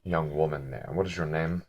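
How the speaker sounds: low, soft, measured menace; intimidating questioner. Acted. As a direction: probe quietly with halting pauses. Plays intimidating Harash.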